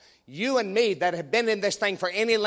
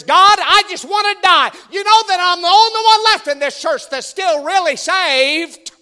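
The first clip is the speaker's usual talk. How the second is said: high, loud self-pitying whine; whiny man child; 10 semitones higher, 8 dB louder than usual. Retold or imitated. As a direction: whine like a big baby. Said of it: "whining like a little baby".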